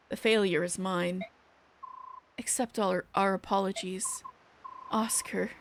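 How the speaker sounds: subdued, formal game dialogue; composed deputy commander. Acted. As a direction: reprimand them calmly, voice held down. Voices stern deputy commander and others.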